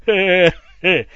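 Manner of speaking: loud, slow, high cackle; gloating cackle. Acted. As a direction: cackle over your own cleverness. (злорадный хохот)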